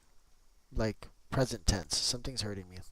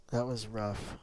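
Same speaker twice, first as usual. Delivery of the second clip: ordinary talk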